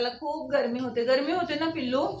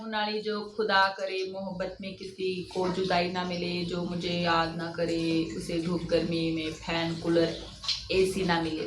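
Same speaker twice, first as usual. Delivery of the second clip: shayari recital; 5 semitones lower than usual. Acted. Viewer curse recited like shayari. (शायरी म्हणण्याच्या ढंगात, खालच्या सुरात)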